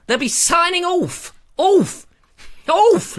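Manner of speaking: hammed-up goodbye to the viewers; mock sing-song farewell. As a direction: trill "Off" up high like a comic send-off.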